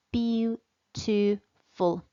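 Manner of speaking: beautiful broken slowly into its three syllables; slow, in chunks. Shown as a demonstration. Teacher showing pupils how to say beautiful in chunks. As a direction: split beautiful into beau-ti-ful, slowly. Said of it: "you just break it into chunks".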